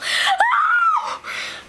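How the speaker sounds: a big, high, breathy gasp of mock shock. Vlogger gasping in fake shock at the reveal, high gasp. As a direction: gasp as if scandalized by the news.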